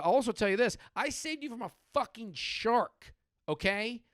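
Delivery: worked-up, entitled comeback; self-righteous husband. Acted. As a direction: lord it over the wife. Acted husband lords rescue over wife.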